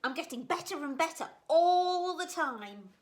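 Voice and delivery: very high and loud; excited cry. Acted. Gooseberry showing off his landing to Beaver, high loud cry. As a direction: crow with pride. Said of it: "cried Gooseberry".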